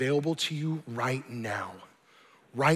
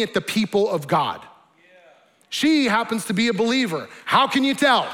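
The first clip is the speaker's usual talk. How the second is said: raised, louder preacher voice; impassioned preaching; 4 semitones higher, 6 dB louder than usual. Acted. Preacher challenging the congregation on mercy and love, impassioned. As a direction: press each question on the congregation.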